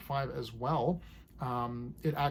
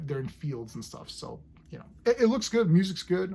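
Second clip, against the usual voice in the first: ordinary talk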